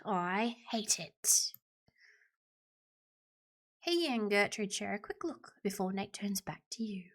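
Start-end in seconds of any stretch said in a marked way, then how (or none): 0.1-1.5 slow, drawn-out whisper. Acted. Character fed up with Nate, whispering. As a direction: whisper it slowly.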